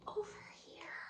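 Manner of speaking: breathy unvoiced whisper, barely audible. A narrator's reading. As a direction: hush it so nothing is startled. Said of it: "I whispered".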